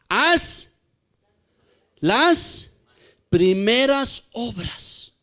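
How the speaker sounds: slow, weighty Haz las primeras obras; solemn quote. Acted. Preacher quoting Jesus to the church, slow and solemn. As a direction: give each word its own beat.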